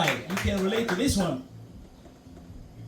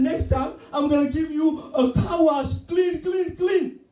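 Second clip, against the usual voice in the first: high, shouted, gleeful promise; 8 semitones higher, 5 dB louder than usual. Retold or imitated. The washer promising the next car wash, shouting gleefully. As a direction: shout the clean clean clean with glee. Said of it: "He said".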